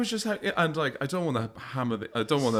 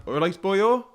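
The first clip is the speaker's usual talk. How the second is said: Welsh accent; 6 semitones higher, slower than usual. Shown as a demonstration.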